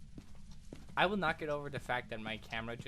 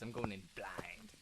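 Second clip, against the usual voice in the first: hushed, slow, breathy whisper; dramatic whisper; 7 semitones lower, 9 dB quieter, slower than usual. Acted. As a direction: whisper it like a secret vow. Dramatic reckless declaration.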